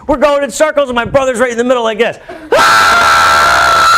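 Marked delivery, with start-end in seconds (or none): 2.5-3.9 loud low bellowing scream; imitated scream. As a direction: let out the brother's howl. It was so loud.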